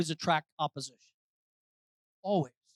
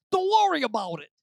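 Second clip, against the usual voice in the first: light New York vernacular, a bit raised; New York accent; 7 semitones higher, 6 dB louder than usual. Shown as a demonstration. Preacher redoing his line for fun, in a New York accent. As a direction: say it like a New Yorker. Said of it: "say it in New York vernacular".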